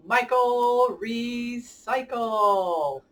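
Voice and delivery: slow, grand title announcement; drawn-out title. Shown as a demonstration. Host slowly unveiling the book title to kids. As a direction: stretch out the title like a reveal.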